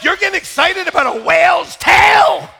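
loud, high demanding rant; indignant rant. Retold or imitated. Speaker ranting at excited tourists about whales, loud indignant. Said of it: "I'm like".

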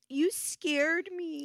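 put-on high, drawn-out little-kid complaint; whiny child. Mother imitating daughter's new complaint, whiny. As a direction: imitate a child's wounded whine. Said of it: "her new thing is".

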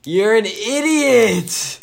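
exasperated shout. Real, not acted. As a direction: yell it slowly at your careless friend. Friend says house address on recording.

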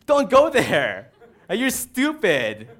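loud laughing yell at a horror movie character; shouting at the screen. Retold or imitated. Moviegoer yelling at a foolish character, laughing shout. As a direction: yell it through a laugh, half joking. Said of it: "you, like, shout".

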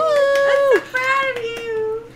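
high, loud, slow cheer. Real, not acted. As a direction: cheer her on like a proud parent. Cheering friend's intro.

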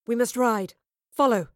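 loud, urgent soothsayer's order; hissed command. A narrator's reading. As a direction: command them forcefully to follow. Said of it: "the soothsayer hissed".

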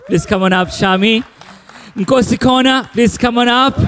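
normal talking voice

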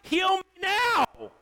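loud, high, whiny demands; mock an impatient believer's complaints; demanding prayer. Believers nagging God for healing, loud and whiny. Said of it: "we come to God and we're like".

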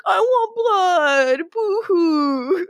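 high, slow fake sobbing; mock whining. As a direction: whimper like a sulky child. Damon whining, tied up in cellar.

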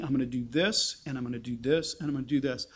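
low, steady and measured, each step laid out; unhurried, low and sure; calm voice of God. Acted. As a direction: speak as a calm God who has it all in hand. God calmly lays out plan.

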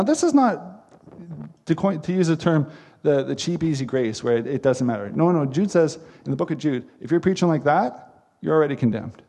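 normal talking voice

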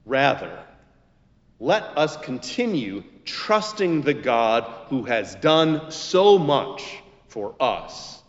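ordinary talk

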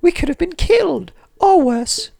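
high prim schoolgirl voice; Hermione impression. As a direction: deliver it like a know-it-all student. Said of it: "she said her famous line".

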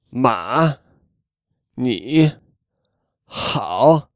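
Ma, ni, hao low then rising at the end; Ma, ni, hao held low, tail up; third tone. Shown as a demonstration. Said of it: "very low for most of the time ... at the end it goes noticeably up".

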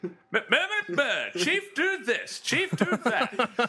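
high-pitched, whiny nag at Chief; mock nagging. Mocking Cortana ordering Chief about, high nagging voice. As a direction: bleat orders in a silly high voice.

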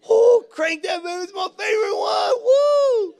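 high, whooping excited shout; hammed-up ecstatic cheering at full volume; thrilled worship fan. New believer cheering a favorite worship song, thrilled shouting. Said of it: "every song was your favorite worship song".

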